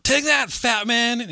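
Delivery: high, drawn-out mock yell; retold yell. Retelling Santa smashing the billboard, mock yell. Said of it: "he yells out".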